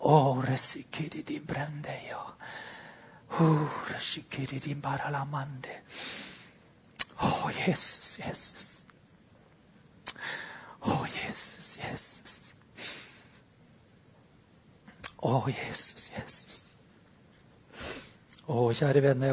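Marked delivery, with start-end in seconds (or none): 0.1-5.8 breathy whispered glossolalia; whispered tongues. Real, not acted. Pastor praying in tongues under his breath, whispered. 7.2-16.6 softer, whispering the name Jesus; whispered prayer. Real, not acted. Pastor whispering to Jesus in worship, hushed.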